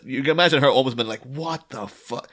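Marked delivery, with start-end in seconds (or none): 1.2-2.3 slow, hushed mock outrage; imitated held-back anger. Ivy over her killed plants, stifled mock fury. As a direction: draw it out softly, swallowing the anger. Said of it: "having to stop herself and being more angry".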